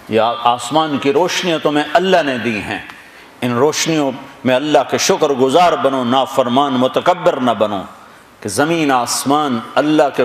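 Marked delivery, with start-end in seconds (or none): none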